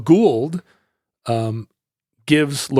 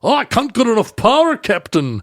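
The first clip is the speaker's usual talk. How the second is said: bad Scottish accent; 9 semitones higher than usual. Host as engineer pleading to the Captain, bad Scottish accent. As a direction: badly fake an urgent Scottish engineer. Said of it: "I can't do ... No, it's not even good".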